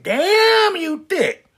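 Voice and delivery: high admiring squeal; impressed exclamation. Acted. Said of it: "she said".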